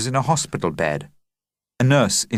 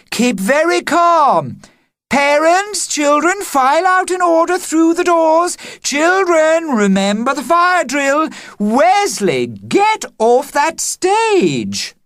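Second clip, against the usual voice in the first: loud commanding; 5 dB louder than usual. Acted. Headmistress commanding evacuation.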